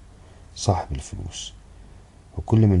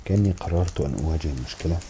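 plain reading voice

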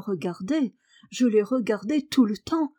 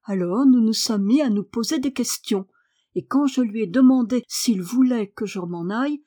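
ordinary delivery, nothing marked

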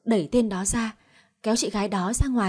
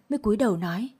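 ordinary delivery

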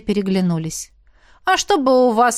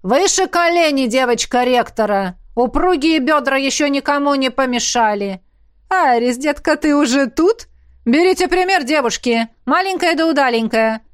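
loud, higher-pitched coach calling out; drill instructor; 5 semitones higher, 4 dB louder than usual. Acted. Coach to trainees running the course, brisk command. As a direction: urge the runners on with brisk shouts.

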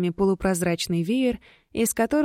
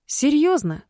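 indignant; 8 semitones higher, 3 dB louder than usual. Acted. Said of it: "возмутилась Генет".

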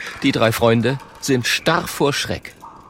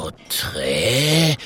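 slow, drawn-out questioning bear; puzzled bear. A narrator's reading. The bear repeats the unfamiliar word, puzzled. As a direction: stretch the word with a rising tail. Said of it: "wiederholt der Bär".

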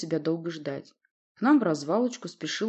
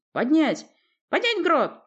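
shouted command; 11 semitones higher, 6 dB louder, slower than usual. A narrator's reading. Captain shouts sail orders.

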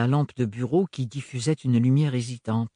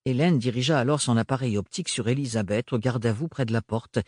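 normal talking voice

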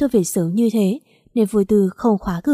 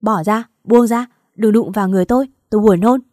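louder disgusted outburst; disgusted shout; 5 dB louder than usual. Acted. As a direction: shove him away with your voice. Wife pushing husband off.

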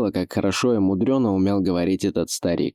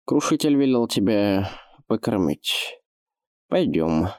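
put-on lisping old orc.

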